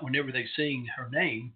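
normal talking voice

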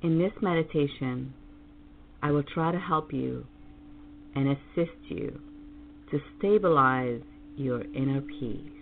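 slow, soothing meditation voice; calm meditative tone. Acted. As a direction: guide them gently into calm. Leads a guided meditation.

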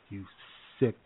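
ordinary talk, nothing marked

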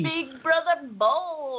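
mock-grand show title announcement; hammed-up announcer. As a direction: stretch it out like a playful announcer. Playful echo of the show title.

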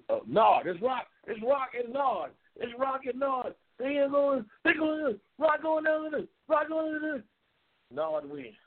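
high, excited play-by-play, slowed at first; replaying his own breathless race commentary; hyped race call. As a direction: call the race like you're losing your mind. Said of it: "And damn it, here I go".

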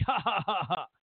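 imitated gloating ha-ha laugh; mock laugh. Stock boy boasting to his buddy, mock laugh. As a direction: laugh along as the boasting character.

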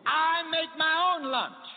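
slow, high, loud declaration of my own lunch; a ringing, drawn-out call from the pulpit; emphatic preaching. Real, not acted.

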